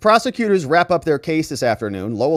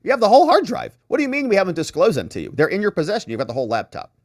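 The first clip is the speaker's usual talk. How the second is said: mock indignant lawyer; 7 semitones higher, faster than usual. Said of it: "And then the defense says".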